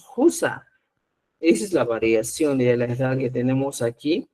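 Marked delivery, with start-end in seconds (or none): 0.0-0.7 "Jusa" said higher, with a rasped j at the start; Chanca Quechua word. Shown as a demonstration. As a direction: teach the word for husband. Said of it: "al esposo decimos". ("Jusa" con j áspera, más aguda)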